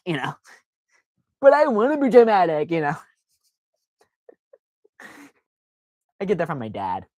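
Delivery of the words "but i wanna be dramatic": high petulant whine; whiny drama queen. Pretend. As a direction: whine it like a spoiled kid.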